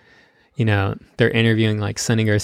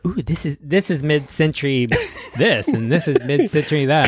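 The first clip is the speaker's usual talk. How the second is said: lightly voiced excited realtor, higher pitch; gushing realtor; 5 semitones higher than usual. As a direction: imitate a house-proud realtor's lilting pitch. Mimics gushing realtor showing a house.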